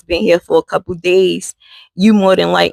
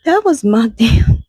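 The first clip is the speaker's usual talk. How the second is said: higher, slowed, breaking into a laugh on damn; laughing disbelief; 5 semitones higher, slower than usual. Real, not acted. Telling viewers it was her mom, laughing in disbelief.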